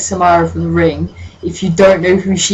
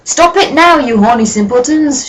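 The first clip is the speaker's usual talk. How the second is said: high, loud scolding teacher; hammed-up shout; 8 semitones higher, 3 dB louder than usual. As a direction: bellow at the couple. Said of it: "shouted Professor McGoggle".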